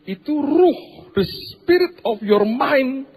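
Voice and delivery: high, loud and slow, hammering each word; emphatic preaching. Real, not acted. Preacher to congregation, pounding out the spirit, high and slow. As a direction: lean hard on "ruh" and drag it out. Preacher stressing key phrase.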